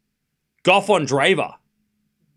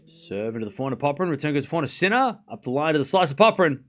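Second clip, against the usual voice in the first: live play-by-play; 4 semitones higher than usual. Real, not acted. Commentator calls Sinner's missed smash, excited live play-by-play. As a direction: follow the rally, then burst out at the miss.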